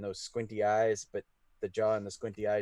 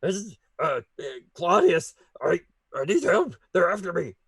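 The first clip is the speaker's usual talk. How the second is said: gruff growly voice; 8 semitones higher, 10 dB louder than usual. Acted. DM voices werewolf pleading for help.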